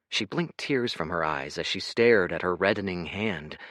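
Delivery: ordinary delivery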